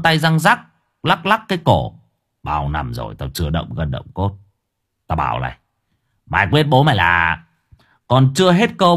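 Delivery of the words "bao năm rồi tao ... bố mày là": tough-guy boast. Acted. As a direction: growl a boast before the fight. Cường boasting before a fight. (giọng trầm đe dọa)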